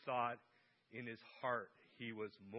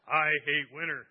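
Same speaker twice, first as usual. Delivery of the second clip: louder mock complaint, I hate winter; 12 dB louder than usual. Shown as a demonstration. Pastor joking with the church about winter, mock loud complaint. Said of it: "That's my go-to thought right now".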